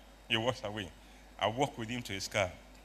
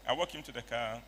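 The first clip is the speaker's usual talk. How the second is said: usual speaking voice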